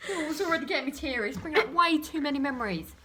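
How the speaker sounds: ordinary delivery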